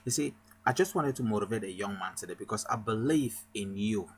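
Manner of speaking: normal talking voice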